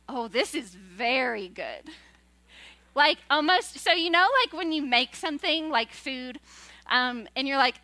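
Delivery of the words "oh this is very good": lower, slower, softer "Oh, this is very good"; admiring God. Retold or imitated. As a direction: savour it like a masterpiece. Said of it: "he goes".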